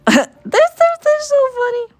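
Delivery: put-on very high squeaky anime girl; cartoonish high-pitched anime squeal. Shown as a demonstration. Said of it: "doing an anime version of that".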